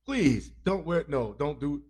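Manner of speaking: ordinary delivery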